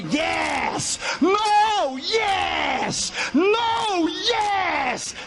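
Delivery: high shouted yes and no alternating; shouting match. Retold or imitated. Back-and-forth shouting on plane.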